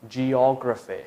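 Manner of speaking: geography said slowly and clearly, stress on the second syllable; model pronunciation. Shown as a demonstration. Teacher modelling geography for students to repeat. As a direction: carefully model geography with a falling end. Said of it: "and again".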